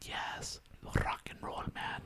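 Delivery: soft whispered cheer; mock-excited, all on breath. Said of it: "whisper boy".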